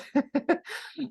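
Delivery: a sudden high burst of laughter; real laugh. Real, not acted. Telling viewers about toying with a scammer, real laugh.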